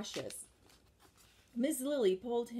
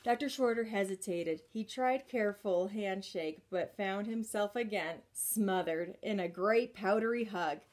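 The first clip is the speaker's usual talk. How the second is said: ordinary delivery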